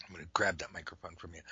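quick, soft whisper off to the side; whispered aside. Real, not acted. Host whispers to his co-host while grabbing the mic, quick whisper. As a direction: whisper it quickly, as if nobody should hear.

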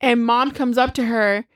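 ordinary talk, nothing marked

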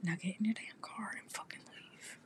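hushed angry whisper; whispered threat. Real, not acted. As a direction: hiss it under your breath. Whispering at stalker outside.

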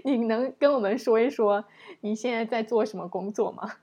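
normal talking voice

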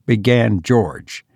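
ordinary delivery

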